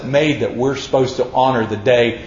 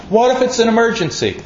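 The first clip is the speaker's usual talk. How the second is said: high fast worried question; mock worried caller; 9 semitones higher, faster than usual. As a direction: play the anxious customer. Imagined caller asks, higher.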